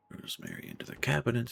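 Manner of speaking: normal talking voice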